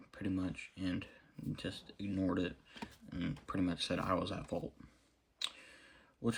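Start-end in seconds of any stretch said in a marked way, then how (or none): none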